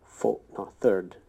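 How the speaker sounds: normal talking voice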